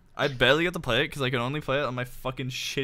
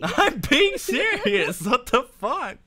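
mock protest; 8 semitones higher, 5 dB louder, slower than usual. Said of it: "You sound so sarcastic".

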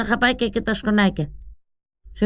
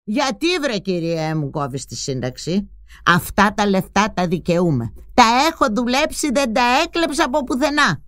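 building indignant rant, rising higher toward the end; 9 semitones higher, 4 dB quieter than usual. Acted. Mother rants at the prime minister, building anger. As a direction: scold an invisible minister, heating up. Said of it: "άρχιζε να φωνάζει".

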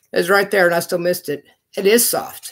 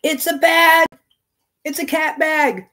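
high-pitched, thrilled discovery; delighted squeal; 8 semitones higher than usual. Real, not acted. Crazy cat guy finds a cat bag, delighted squeal. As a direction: squeal with delight over a cute find.

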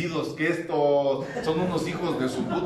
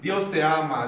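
ordinary talk, nothing marked

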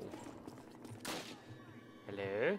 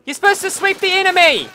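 loud higher yell, then faster; angry outburst; 9 semitones higher, 6 dB louder, faster than usual. Real, not acted. Gamer yelling at the cheating game, angry outburst. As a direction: rant at the game's unfairness.

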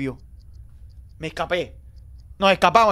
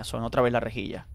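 ordinary delivery

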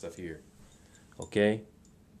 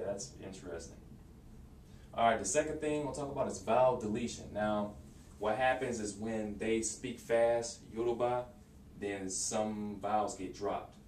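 ordinary delivery

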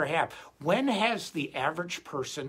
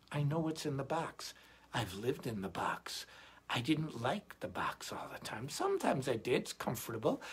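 soft musing; 8 dB quieter than usual. Real, not acted. Quiet reflective aside about the box.

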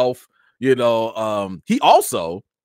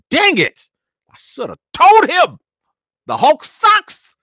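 much higher and louder, dragged out slowly; mock frustrated outburst; 11 dB louder, slower than usual. Kicking himself the day after meeting Hulk, mock outburst. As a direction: ham up the regret, stretching the words.

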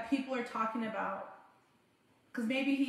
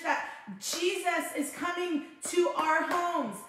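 ordinary talk, nothing marked

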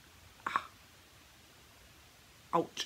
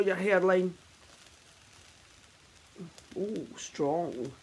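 lips stiff under mask; talking while peeling mask; through a face mask. Real, not acted. Seen 3: Pink peel-off mask covers face; she pulls it off while speaking.

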